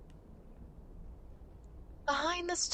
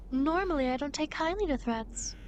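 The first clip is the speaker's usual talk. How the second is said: usual speaking voice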